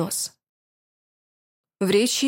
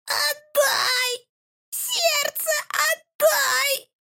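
very high, slow whining witch cry; plaintive whimper; much slower than usual. Acted. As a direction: whine like a spoiled child for it. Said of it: "жалобно захныкала ведьма". (жалобное хныканье ведьмы)

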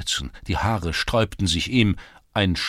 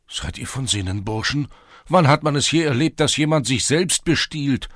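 indignant protest; 8 semitones higher, 6 dB louder than usual. A narrator's reading. Czerewik protests arrest.

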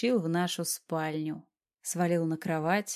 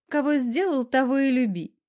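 higher, lightly huffy comeback; snorted retort; 6 semitones higher, 5 dB louder than usual. A narrator's reading. Wife retorts to her grumbling husband, huffy. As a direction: toss it back with a snort. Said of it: "фыркнула она".

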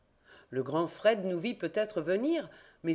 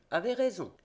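ordinary delivery

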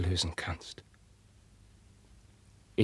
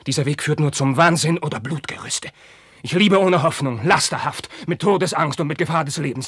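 much higher and louder, desperate; anguished shout; 7 semitones higher, 8 dB louder than usual. Acted. Carlos crying out his hopeless love to Roderich, anguished shout. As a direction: let the despair burst out. Outburst of despair.

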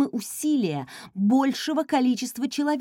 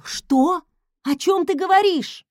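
surprised; 8 semitones higher, 5 dB louder than usual. Acted. Heroine cuts off friend.

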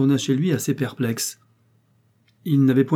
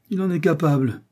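higher, teasing jeer; mocking jibe; 7 semitones higher than usual. A narrator's reading. Father teasing his daughter about Fredo, higher and mocking. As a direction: poke fun at the boyfriend. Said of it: "gouailla monsieur Richard".

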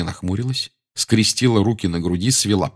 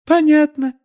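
very high, louder sugary coo; 5 dB louder than usual. A narrator's reading. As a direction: coo it sweetly. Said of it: "проворковала профессор Амбридж".

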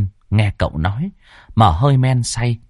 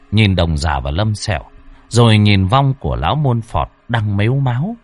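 plain reading voice

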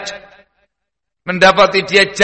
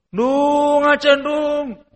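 put-on high drawn-out call to a child; long high call across the yard; 10 semitones higher, much slower than usual. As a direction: stretch the name out slowly as if calling from afar. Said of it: "Teriak dia".

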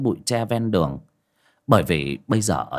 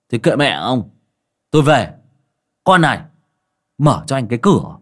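put-on a slightly louder, loosely drunk master; drunken slur; 6 dB louder than usual. A narrator's reading. Tipsy master telling his host he is leaving, loosely slurred. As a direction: play the tipsy master heading home. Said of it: "say líu cả lưỡi".

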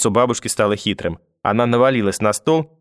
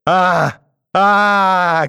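high, drawn-out wailing cries; two loud wails, the second longer; screaming; 9 semitones higher, 5 dB louder than usual. A narrator's reading. Grandmother clutching her face, screaming. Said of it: "кричала она".

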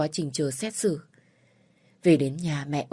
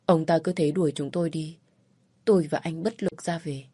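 ordinary delivery, nothing marked

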